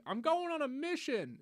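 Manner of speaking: high, slow sing-song; mock heroic player voice. Explaining how missions start, high slow mock voice. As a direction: ham up the player announcing a mission.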